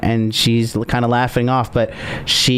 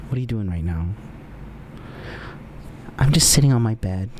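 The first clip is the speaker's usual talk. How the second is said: low flirty; 5 semitones lower, 7 dB quieter, faster than usual. Pretend. Voicing himself flirting on phone.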